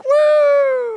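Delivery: high whooping cheer; excited cheer. Retold or imitated. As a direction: whoop like the crowd erupting. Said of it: "you're like".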